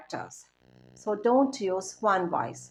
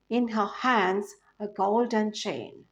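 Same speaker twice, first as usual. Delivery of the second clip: normal talking voice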